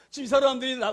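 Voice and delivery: ordinary talk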